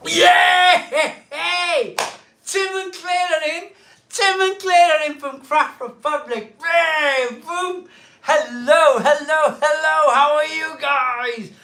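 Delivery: high, whooping, drawn-out greeting; excited cheer. Real, not acted. Host welcoming Tim and Claire to the stream, excited cheer. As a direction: hail friends with loud, high delight. Cheering viewers who tuned in.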